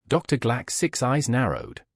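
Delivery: ordinary delivery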